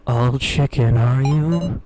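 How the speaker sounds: ordinary delivery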